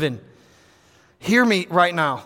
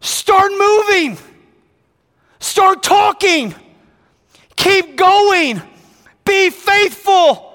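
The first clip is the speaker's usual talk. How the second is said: higher, louder, slow commands; God shouting at the church to move; God's urgent command; 9 semitones higher, 5 dB louder than usual. Retold or imitated. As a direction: drive them to get moving. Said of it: "he goes".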